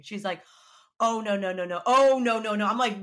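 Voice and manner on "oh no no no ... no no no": friend's rapid string of no's, getting louder; retold alarm. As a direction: imitate an alarmed friend, building on the repeat.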